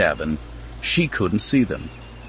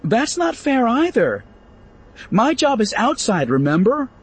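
put-on much higher young woman's complaint; anguished protest; 13 semitones higher than usual. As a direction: plead unfairness with a high voice. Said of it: "anguished look".

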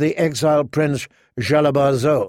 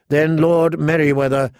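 ordinary delivery

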